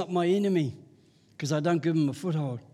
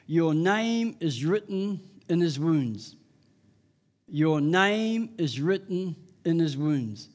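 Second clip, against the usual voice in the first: slow emphasis; 2 semitones lower, slower than usual. Real, not acted.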